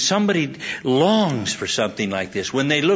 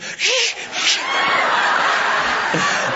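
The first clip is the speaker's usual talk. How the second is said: two sharp hisses like a cat; imitated cat hiss. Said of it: "the cat goes".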